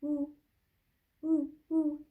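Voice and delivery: high, hooted owl call; demonstrating an owl's hoot. Shown as a demonstration. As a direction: hoot like an owl in short even notes. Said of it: "make sounds like an owl by going".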